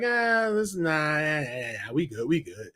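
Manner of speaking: low, soft, dismissive "nah, we good"; chill brush-off. Retold or imitated. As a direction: wave it off lazily, dropping low and quiet. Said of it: "it was just like".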